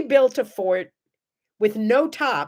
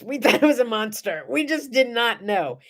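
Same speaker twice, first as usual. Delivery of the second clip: ordinary talk, nothing marked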